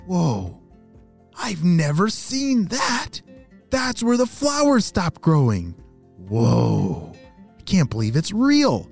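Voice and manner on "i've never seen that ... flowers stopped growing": excited wonder. Acted. Pedro sees far land.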